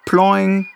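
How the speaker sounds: hoch und lauter nachgeahmt; Ploing-Geräusch. A narrator's reading. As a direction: das Fallen lautmalerisch hüpfen lassen. Geräusch der fallenden Maulbeere. (Erzählerin macht das Maulbeer-Geräusch, hoch gesprochen)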